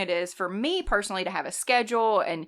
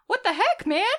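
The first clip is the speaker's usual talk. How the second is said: high mock bafflement; a professor kindly puzzled by the mess; imitated professor; 11 semitones higher, 4 dB louder than usual. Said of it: "She was just like".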